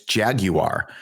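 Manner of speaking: "Jaguar" slowly, in three syllables; British Jaguar. Shown as a demonstration. Co-host mock-correcting Americans, "Jaguar" said "JAG-you-ar". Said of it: "Can we just correct all these".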